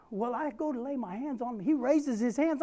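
ordinary talk, nothing marked

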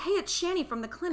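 high, bright phone greeting from the clinic nurse; a cheery nurse calling a patient, imitated; chirpy nurse voice. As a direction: sing out the hello like a cheery receptionist. Retelling clinic nurse's phone call.

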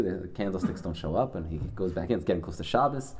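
ordinary delivery

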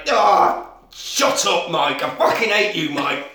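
loud mock-irritated sheriff; comic grumbling outburst; acted exasperation. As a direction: rant as a fed-up lawman, pitch jumping up. Sheriff fed up with Mike's call.

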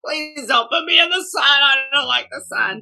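a flower's high, loud pleading voice; flower pleading, nearly shouting; talking flower. Pretend. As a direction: play the flower, loudly begging to stay out of the sun. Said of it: "the flower could talk would be like".

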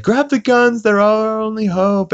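high, loud put-on panic; panicked townspeople. Townspeople panicking as Stewies attack, high loud alarm.

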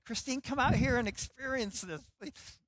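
mock call out to Christine. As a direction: call her over from across the railing. Calling wife across the railing.

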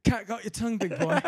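high mocking taunt; taunting Gordon. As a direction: tease the old man, hammed-up.

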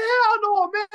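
high-pitched retold protest; mock-outraged high refusal; indignant refusal. Retelling his angry refusal.

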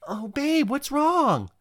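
put-on high, sleepy girlfriend voice; lightly voiced sleepy girlfriend. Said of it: "she goes".

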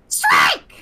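very low, loud announcer bellow of Strike!; Toad as bowling announcer. Acted. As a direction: boom it out like an arena announcer. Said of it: "here's Toad if I'm like a bowling announcer".

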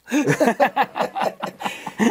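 a sudden burst of loud, high laughter; real laugh at the memory; hearty laugh. Real, not acted. Laughing at a bad review.

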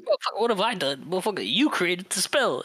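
indignant Peter. Retold or imitated. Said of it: "He's like".

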